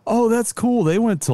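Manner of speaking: high-pitched impressed exclamation; imitated inner reaction. Co-host retelling what was in his head, high impressed reaction. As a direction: jump up high, retelling your first thought. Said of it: "in my head, I was like".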